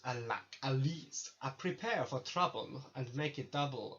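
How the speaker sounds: hammy mock-Russian villain motto; terrible Russian-accented impression; accidental Russian accent. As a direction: recite it with a bad Russian accent. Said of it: "I don't know why I'm in Russian".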